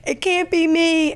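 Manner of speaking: high, whiny protest; mock crying. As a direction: plead it like a weeping friend. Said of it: "And she's crying".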